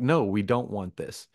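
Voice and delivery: normal talking voice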